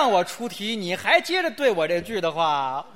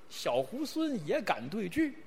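soft punchline; 11 dB quieter than usual. Acted. Punchline of monkey story.

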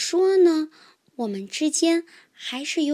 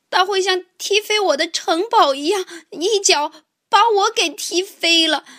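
high, loud alarm; panicked; 6 semitones higher, 4 dB louder than usual. Acted. Mouse dreading being kicked by tiger, alarmed. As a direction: fret out loud in mounting panic.

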